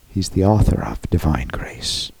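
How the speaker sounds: hushed, breathy emphasis; whisper. Real, not acted. Preacher whispering to the congregation about grace. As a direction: drop to a whisper for weight.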